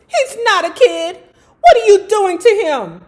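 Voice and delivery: high, loud, breaking cry; tearful plea. Acted. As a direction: plead through tears. Narrator pleads, voice breaking.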